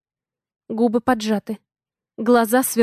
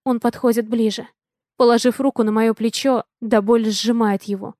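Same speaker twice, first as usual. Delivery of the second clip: ordinary delivery, nothing marked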